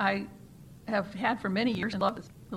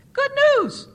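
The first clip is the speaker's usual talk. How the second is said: much higher, loud joyful shout; rooftop shout; 12 dB louder than usual. A narrator's reading. Members shout down from the church roof, high and loud. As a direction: call it out from the roof. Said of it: "and shouted".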